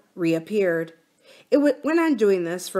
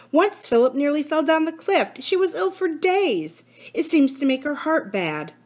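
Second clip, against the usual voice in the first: Dinah; 8 semitones higher than usual. A narrator's reading. Dinah voiced in story.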